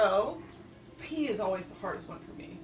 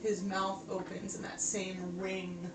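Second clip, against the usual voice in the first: ominous narration; 3 semitones lower, 6 dB quieter than usual. Acted. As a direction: build dread over the cages. Game master continues the description.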